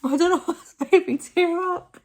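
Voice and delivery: high, loud laugh while tearing up; laughing through tears. Real, not acted. Telling viewers why the clip moves her, teary laughter. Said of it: "I'm just laughing because".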